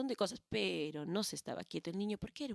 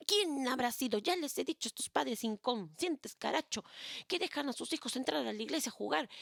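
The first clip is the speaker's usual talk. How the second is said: exasperated priest complaining; angry priest; 5 semitones higher than usual. Acted. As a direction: scold like a fed-up old priest. Priest rants about the culprits.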